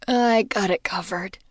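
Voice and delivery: words stretched by a yawn; mid-yawn. A narrator's reading. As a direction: say it through a sleepy yawn. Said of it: "Hurley says mid-yawn".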